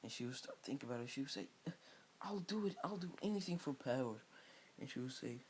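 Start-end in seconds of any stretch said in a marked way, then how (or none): 2.2-4.2 eager Sakura. Retold or imitated. Sakura accepts power.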